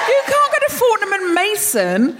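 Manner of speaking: slow, loud, high exasperated protest; exasperated shout. Acted. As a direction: shout in mock outrage. Mock outrage at slow eating.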